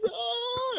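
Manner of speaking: very high acted shriek; freaked-out scream. Jumper tumbling into free fall, freaked-out scream. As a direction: shriek in mock panic. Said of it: "freaking out".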